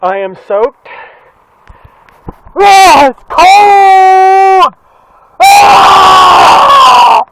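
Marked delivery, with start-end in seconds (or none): 2.5-7.2 loud, higher mock yell about the cold; hammed-up cold shout. Vlogger hamming it up for her viewers, mock shouting. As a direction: overplay the shock of the cold rain. Acted rain drama before "just joking.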